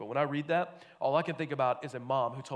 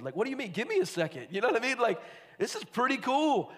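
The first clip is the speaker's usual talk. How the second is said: high-pitched, excited gawker; amazed protest; 8 semitones higher than usual. Acted. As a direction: plead for a moment, thrilled and awestruck. Awestruck disciple protests being hurried.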